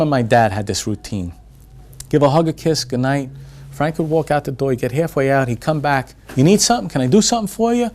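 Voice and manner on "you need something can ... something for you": high, loud, quick retold questions; eager retold pleading to be useful; insistent offer. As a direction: imitate someone pressing to help. [name] keeps coming back offering help.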